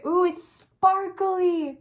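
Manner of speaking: high, loud, stretched-out squeal of delight. Real, not acted. Vlogger spotting a glittery lip colour, delighted. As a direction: draw it out high and loud, thrilled.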